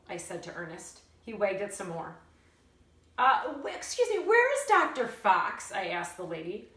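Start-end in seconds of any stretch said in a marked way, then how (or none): 3.2-5.7 put-on eager child. A narrator's reading. Child calls out to the lady.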